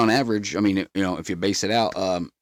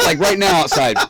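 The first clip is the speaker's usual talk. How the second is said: louder, laughing through it; laughing emphasis; 7 semitones higher, 6 dB louder than usual. Real, not acted. Laughing to his friend about the cold night. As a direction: laugh at how cold it was.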